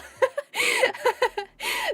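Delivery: long high giggle; amused laugh. Real, not acted. Streamer tickled by donor saying nah, amused laugh.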